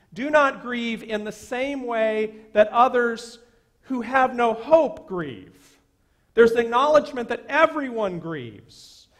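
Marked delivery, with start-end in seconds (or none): 0.1-5.8 louder, slow on "who have no hope grieve"; preacher pressing "hope" loud and slow; emphatic restatement. Shown as a demonstration. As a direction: drive home "hope". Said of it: "the best translation of the original Greek".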